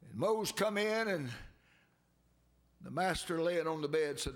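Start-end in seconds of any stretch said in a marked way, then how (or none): none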